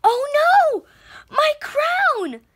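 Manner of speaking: high, loud "oh no" of alarm; alarmed cry. A narrator's reading. Girl sees her crown fly off her hat, alarmed cry. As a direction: cry out as the crown flies away.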